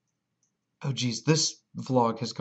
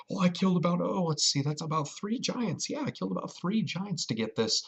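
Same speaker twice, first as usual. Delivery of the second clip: showing off; 7 semitones higher than usual. Retold or imitated. Bragging to the crowd.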